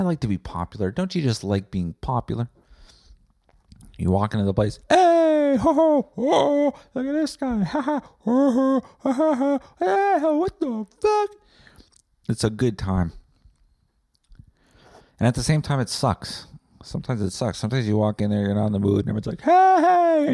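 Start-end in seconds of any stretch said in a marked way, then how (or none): 4.9-11.3 hammed-up jolly crowd hailing a regular; rowdy crowd greeting. Crowd greeting popular guy. 19.4-20.3 high, loud crowd hello; loud cheery greeting. Retold or imitated. Everyone greets him.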